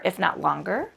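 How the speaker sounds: normal talking voice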